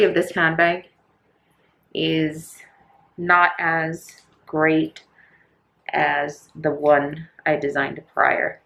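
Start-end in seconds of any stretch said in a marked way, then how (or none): none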